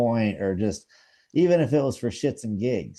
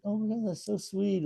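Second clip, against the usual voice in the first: mock gushing delight, much higher; gushing girl; 11 semitones higher than usual. Girl hearing about big game hunting gushes in mock delight, much higher. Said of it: "And like".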